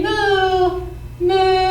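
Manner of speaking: put-on a kid-friendly cow's moo, sung up high. Shown as a demonstration. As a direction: moo out high and playful for kids. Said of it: "Look up ahead and say".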